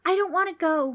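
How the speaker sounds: put-on loud, very high boy's cry; frightened shout. A narrator's reading. Preston shouts into the darkness, pleading loudly. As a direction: shout out in high-pitched panic. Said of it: "Preston shouted into the darkness".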